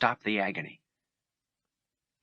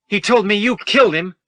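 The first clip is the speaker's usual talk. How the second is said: higher, much louder furious yell; angry yell; 12 semitones higher, 8 dB louder than usual. Acted. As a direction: hurl it at him in anger. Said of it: "he yelled".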